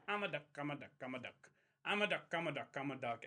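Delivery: lightly voiced silly duck, soft and low. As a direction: murmur it like a dumb duck paddling. Voicing the duck in a story.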